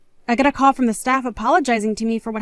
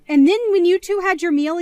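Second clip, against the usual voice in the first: ordinary delivery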